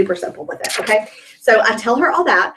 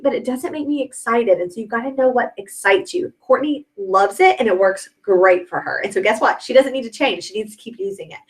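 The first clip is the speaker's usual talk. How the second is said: ordinary talk, nothing marked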